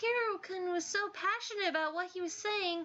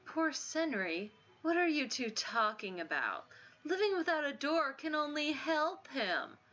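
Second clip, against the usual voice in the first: scornful bully. Acted. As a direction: sneer it down.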